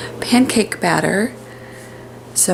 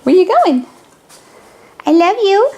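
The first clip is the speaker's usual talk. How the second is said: pet talk; 12 semitones higher, 6 dB louder than usual. Acted. Cooing to her dog.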